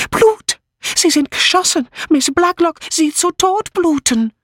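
very high, hysterical cry; panicked gasp. A narrator's reading. Mitzi sees blood on Miss Blacklock, panicked gasp. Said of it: "keuchte sie".

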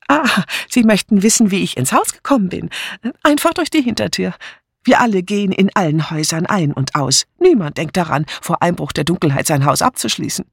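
high voice, easing down towards the end; chatty neighbour woman. Acted. Neighbour telling the sergeant how she got in, chatty. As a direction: explain it readily in a lifted lady's voice. Mrs Swettenham explains the back door.

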